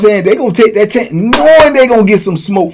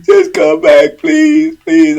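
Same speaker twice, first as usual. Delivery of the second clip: imitated begging. Mimicking the man on his knees to Boo Boo, pitiful begging. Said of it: "get on his knees".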